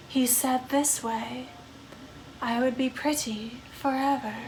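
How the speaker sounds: slow, soft, mournful vampire voice; a lonely undead woman drifting through her sorrows; brooding vampire ghost. Acted. Vampire ghost confiding in a lonely spirit, slow and hushed. Vampire character in dating game.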